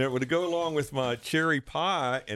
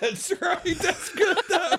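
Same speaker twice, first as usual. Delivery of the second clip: squeaky high voice; slower than usual. Real, not acted. Laughing in the squeaky voice.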